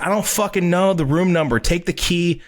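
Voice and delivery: high-pitched, frazzled retort; stressed out. Retold or imitated. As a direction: brush off the valet in a retold panic. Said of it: "I'm stressed out".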